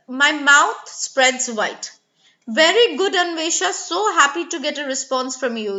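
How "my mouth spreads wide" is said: slow, drawn-out explanation; slow teacher demo. Shown as a demonstration. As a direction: stretch the words to show the mouth spreading. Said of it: "You can see in a".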